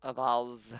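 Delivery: "vase" with a broad ah, as "vahz"; "vase" drawn into an ah vowel; pronounced "vahz". Real, not acted. Group trades pronunciations of vase.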